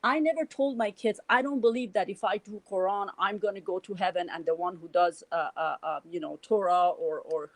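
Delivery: ordinary delivery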